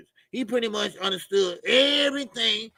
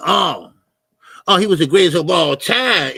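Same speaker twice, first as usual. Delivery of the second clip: sudden loud "oh" of understanding; loud realization; 9 dB louder than usual. Real, not acted. Streamer suddenly gets what the chatter meant, loud realization. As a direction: burst out as it finally clicks.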